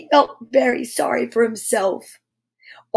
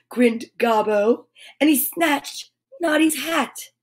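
plain reading voice

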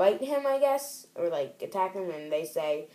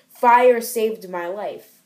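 mock dramatic; 3 semitones higher, 10 dB louder, much slower than usual. Character's dramatic line.

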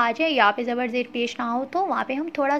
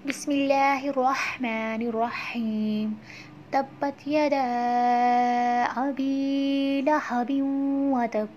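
slow, drawn-out recitation; measured tajweed reading; Quran recitation; slower than usual. Shown as a demonstration. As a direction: recite the verse slowly. Recites new surah.

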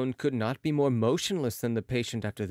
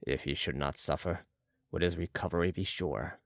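low, soft character voice; Balsamo questioning; 8 semitones lower, 5 dB quieter than usual. A narrator's reading. Balsamo asks Marat about recovery, low and quiet. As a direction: sink the pitch, calmly asking.

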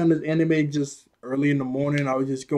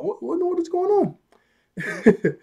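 very high, slow mock bewilderment; replaying his own confusion at the subtitles, squeaking high and slow; confused high squeak; much slower than usual. Telling viewers his shock at the subtitles, high and drawn out. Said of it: "I was like".

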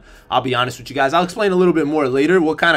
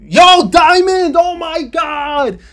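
much higher and louder, drawn-out yell; full-throated hype shout; ecstatic scream; 13 semitones higher, 9 dB louder, much slower than usual. Real, not acted. Gamer screams on pulling a diamond, ecstatic.

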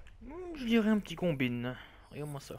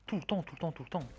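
muttering; 5 semitones lower, 10 dB quieter, much faster than usual. Real, not acted. Complaining about repeated cheap kills.

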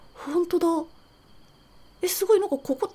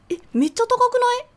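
startled; 9 semitones higher, 5 dB louder, faster than usual. Real, not acted. Startled at the height.

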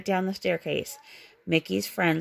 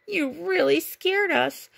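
slow, higher, wide-eyed admission; lingering fright; 8 semitones higher, slower than usual. A narrator's reading. Minnie tells Mickey he scared them, slow shaken voice. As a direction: draw it out still shaken.

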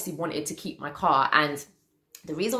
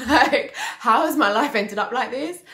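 higher, louder, incredulous laugh in the words; laughing disbelief; 8 semitones higher, 5 dB louder than usual. Real, not acted. Vlogger looking back on her worst day, laughing disbelief. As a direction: ask it through laughter, pitched up.

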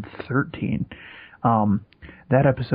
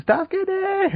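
very high mock scream for help; high-pitched pretend cry for help; imitated yelling. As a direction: imitate kids yelling, pitch way up, volume held back. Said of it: "yelling at the top of their lungs".

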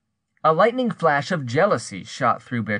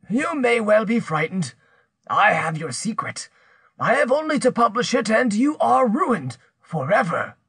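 higher-pitched, menacing accuser's voice; threatening accuser; 9 semitones higher than usual. Acted. Pierre threatens to publish her secret, menacing. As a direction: press the threat, drawing out the ruin.

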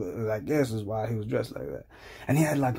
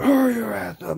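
higher, mock-angry command; impatient billionaire; 8 semitones higher, 4 dB louder than usual. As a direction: hurry him along, acted irritation. Acts impatient billionaire ordering conservative.

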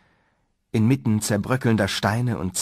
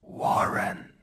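slow, toneless inhuman whisper; distant, hollow, ghostly whisper; unearthly hollow voice; 9 dB quieter, much slower than usual. A narrator's reading. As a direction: whisper like a bodiless thing from the grave. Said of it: "die Stimme sei tief gewesen".

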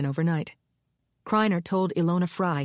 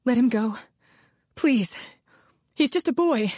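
higher, rising desperate plea of a mother; pleading; 6 semitones higher than usual. A narrator's reading. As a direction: beg for her son's life. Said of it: "Maddie pleaded".

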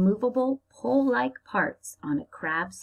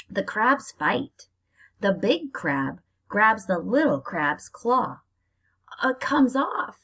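dramatic story reading; 2 semitones higher, 4 dB louder, slower than usual. Acted. Teacher reading the crab fight to her class, dramatic. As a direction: make the crab fight exciting for the kids.

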